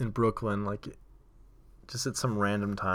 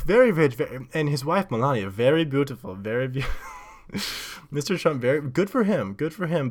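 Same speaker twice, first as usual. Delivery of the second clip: higher, gushing mock Polish accent; Polish-accented praise, punctuated by giggles; excited Polish accent; 5 semitones higher, 3 dB louder than usual. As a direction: imitate an admiring tourist with a Polish accent. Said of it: "I'm pretty sure it was Polish accent".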